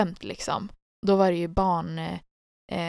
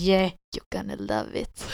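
mock ASMR; 11 semitones lower than usual. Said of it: "för er A S M R-lyssnare".

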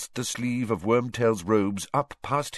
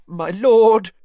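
high, loud, choked cry; choking plea; 13 semitones higher, 11 dB louder than usual. Acted. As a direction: beg through a tight throat. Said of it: "he choked".

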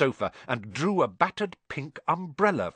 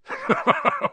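big hearty chuckle, much higher; 4 dB louder than usual. Acted. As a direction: laugh warmly at the boy's doubt. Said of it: "Hagrid chuckled".

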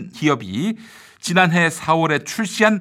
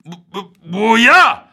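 suddenly muffled censor; 3 semitones higher, 6 dB louder, much slower than usual. Real, not acted. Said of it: "왜 삐 처리를 해?".

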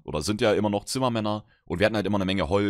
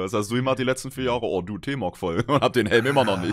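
ordinary delivery

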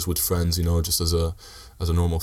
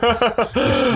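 laughing; 4 dB louder than usual. Real, not acted. Laughs while he starts to speak.

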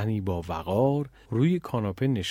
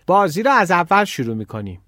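loud, higher-pitched shout to playmates; shouted call; 6 semitones higher, 8 dB louder than usual. A narrator's reading. Girl calling to the other children to restart, shouting. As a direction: call it out loudly across a yard. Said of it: "فریاد زد".

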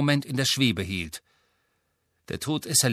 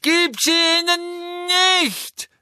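much higher, louder slow bellow; Neville bellowing; 6 dB louder, slower than usual. A narrator's reading. Neville begging Harry, desperate bellow. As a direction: scream it in desperation. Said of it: "brüllte Neville".

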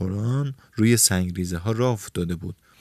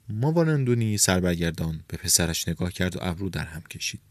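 usual speaking voice

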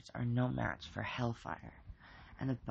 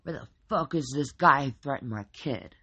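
tough guy voice; 7 dB louder than usual. A narrator's reading. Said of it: "his best tough guy voice".

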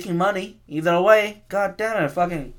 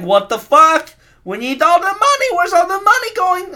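much higher and louder, greedy shareholders in a mock outrage; mock angry shareholders; 7 dB louder than usual. Shareholders demanding profits, mock shrill outrage. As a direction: yell it like a greedy investor losing money. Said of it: "Our shareholders like".

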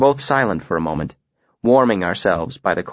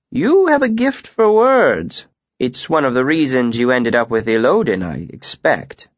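Master Dahl; 2 semitones higher than usual. Acted. Master Dahl appraises the student.

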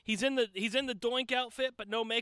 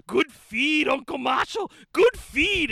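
higher, louder shout, climbing on the last good feed; loud ring call; 5 semitones higher, 8 dB louder, slower than usual. Retold or imitated. Wrestler praising his manager mid-match, loud shouted call. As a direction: bark it so the ring hears. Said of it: "you can hear him".

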